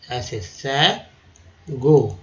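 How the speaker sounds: सैगो said slowly with plain s; slow variant. Shown as a demonstration. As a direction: contrast सैगो with शैगो. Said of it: "ठीक है, शैगो". (सैगो धीरे बोलें)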